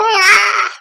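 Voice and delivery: high loud startled yelp; short squeaky shriek; mock scream. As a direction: scream in fake fright. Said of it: "And then I go".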